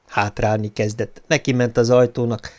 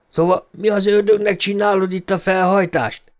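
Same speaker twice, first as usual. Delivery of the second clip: gruff father; 8 semitones higher than usual. A narrator's reading. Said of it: "kérdezte".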